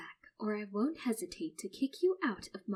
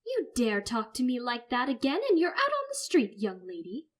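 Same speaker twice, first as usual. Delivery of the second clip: angry threat; 4 semitones higher, 8 dB louder than usual. Acted. Mother threatens son.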